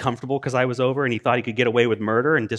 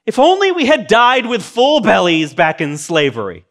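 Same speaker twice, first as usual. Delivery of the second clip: whiny complaint; 7 semitones higher, 7 dB louder than usual. Acted. Israelites complaining, acted.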